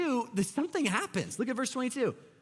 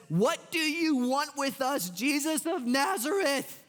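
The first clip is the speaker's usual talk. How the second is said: mock crying out; 7 semitones higher than usual. Possessed man crying out at Jesus, mock scream at preaching volume. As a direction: stretch it out as a half-shouted demand. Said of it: "it starts screaming out".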